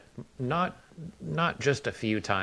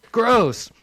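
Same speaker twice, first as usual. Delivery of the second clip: high, loud blurt of disgust; mock disgust; 13 semitones higher, 9 dB louder than usual. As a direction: blurt it out, pitched way up. Acted blurted reaction to a fart.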